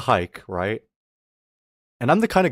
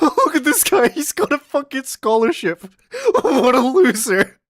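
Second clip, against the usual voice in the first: very high, louder cackling sneer; mocking bully; 13 semitones higher, 5 dB louder than usual. Imitating classmates laughing at scholarship boy, high cackling sneer. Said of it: "They laughed at him like".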